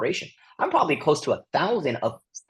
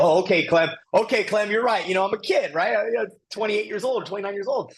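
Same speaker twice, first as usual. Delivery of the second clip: sheepish deference; 8 semitones higher than usual. Retold or imitated. Retold deferring to mentor.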